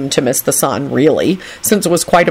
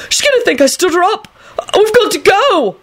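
desperate yell. A narrator's reading. Young man fears he stood her up, desperate yell. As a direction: wail it in a desperate panic. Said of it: "he yelled desperately".

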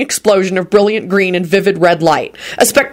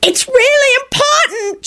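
very high, drawn-out whine; whining shriek; slower than usual. A narrator's reading. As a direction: shriek it in a slow, piercing whine. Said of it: "shrieked a loud, whining voice".

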